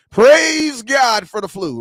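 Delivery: high, loud, slow churchy praise; hammed-up loud sermon praise; mock preacher. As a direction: ham it up like a preacher.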